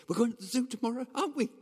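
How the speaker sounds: put-on high, rapid, eager child asking; excited child. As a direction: gabble it high like a child bursting for an outing. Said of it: "It's 'cause they're excited".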